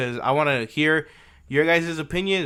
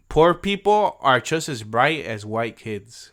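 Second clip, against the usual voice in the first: slow quoted gaffe; much slower than usual. Retold or imitated. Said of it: "He said".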